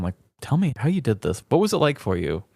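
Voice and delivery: ordinary delivery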